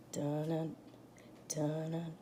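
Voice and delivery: low, hushed dun-dun of shark music; Jaws music hum. Acted. Teacher humming shark music to scare the class, low and hushed. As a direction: hum the shark theme to build dread.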